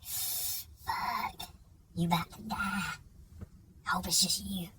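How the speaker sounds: unvoiced, hushed dread; whisper. Silently fearing for his buddy, whispered. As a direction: breathe it out in a scared whisper. Thinking fearfully to himself.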